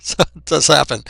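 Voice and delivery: a real laugh, then words still bubbling with it. Real, not acted. Reactor to viewers after a crime story, laughing loudly. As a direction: laugh it off, loud and rueful.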